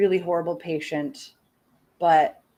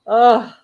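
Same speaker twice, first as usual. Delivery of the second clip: loud, higher "ugh"; exasperated groan; 4 semitones higher, 9 dB louder than usual. Real, not acted. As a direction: let out a fed-up groan. Disgusted groan after venting.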